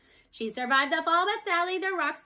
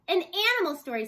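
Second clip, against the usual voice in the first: insistent request; 6 semitones higher, 6 dB louder than usual. A narrator's reading. Children competing for story.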